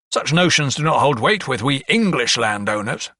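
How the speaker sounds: English leaned on above the other words; stress on English. A narrator's reading. Lawyer tries to alienate the young doctor, stressing English. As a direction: punch the word English to shut the listener out. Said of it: "He put particular emphasis on the word English".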